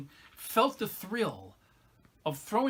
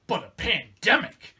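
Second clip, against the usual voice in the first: big, loud, high exclamation; emphatic exclamation; 4 semitones higher, 9 dB louder than usual. Acted. Monologuist unveils the big item on his bucket list, exclaiming. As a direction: punch each word out, louder and higher. Comic monologue builds to this punch.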